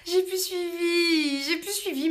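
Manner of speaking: hammed-up pretend sob over losing the thread; mock wail. Streamer mock-wailing to viewers after losing the thread.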